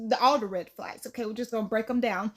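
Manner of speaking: ordinary talk, nothing marked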